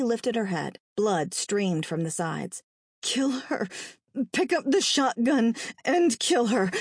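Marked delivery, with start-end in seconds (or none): 3.0-6.7 higher, halting strained voice; strained, broken command from the wounded; dying mother's urging. A narrator's reading. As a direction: urge it with your last breaths. Dying mother urges son.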